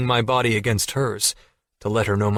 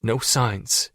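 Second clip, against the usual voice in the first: ordinary delivery, nothing marked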